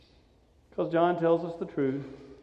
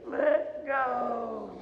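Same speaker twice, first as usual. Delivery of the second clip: soft, drawn-out strained cry; strained let-go; 3 semitones higher, 7 dB quieter than usual. Acted. Jesus caught in Mary's tight grip, strained plea. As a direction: squeeze it out slowly, as if being clutched. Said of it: "The Greek implies a little more of".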